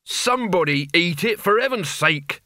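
acted shout that nobody hears; exasperated shout. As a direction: call out in impatient exasperation. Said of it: "shouted Mort, totally unheard".

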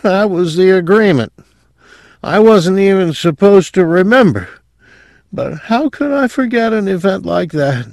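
high, dazed sedated patient; drugged mumble. A narrator's reading. Sedated patient tells of an agreement, in a high dazed voice. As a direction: drift through it in a high drugged voice. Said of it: "mumbled in a dull, drug-saturated voice".

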